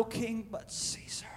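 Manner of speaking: hushed, whispered answer. Acted. Chief priests answering Pilate about their king, whispered. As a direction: drop to a whisper for the reply.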